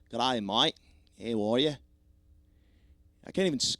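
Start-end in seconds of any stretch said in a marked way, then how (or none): 0.1-1.8 loud, rough attempt at an Australian accent. Shown as a demonstration. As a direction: greet like a caricature Australian. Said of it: "how do you even sing Australian".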